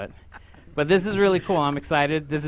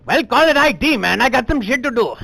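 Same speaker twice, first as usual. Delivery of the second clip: louder, fed-up snap; impatient; 7 semitones higher, 6 dB louder than usual. Acted. Inventor tells the fussy clerk to just call it IT, fed up. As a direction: brush the clerk off loudly.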